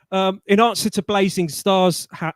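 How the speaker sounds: normal talking voice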